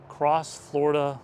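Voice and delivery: ordinary delivery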